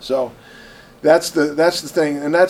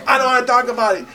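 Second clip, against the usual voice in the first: quick, higher, sharp brush-off; retold angry brush-off; 10 semitones higher, 7 dB louder, faster than usual. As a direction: snap it off fast and high. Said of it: "he goes".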